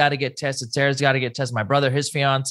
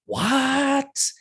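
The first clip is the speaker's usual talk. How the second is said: mock shock; 12 semitones higher than usual. Shocked at cousin's lost smell.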